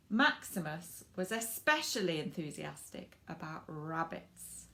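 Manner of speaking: lively storybook narration for little ones; reading to children. A narrator's reading. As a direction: read it like bedtime story time. Reads picture book to children.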